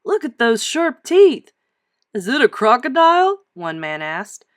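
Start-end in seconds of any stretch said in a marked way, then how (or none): 0.0-1.5 excited. Acted. Men marvel at bones. 2.1-3.4 curious man voice. Acted. One man asks.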